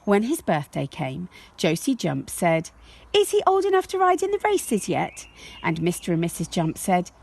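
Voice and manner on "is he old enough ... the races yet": put-on high, quick child's voice asking; a small girl begging to be grown up; eager little girl. Josie asks her parents, high eager child voice.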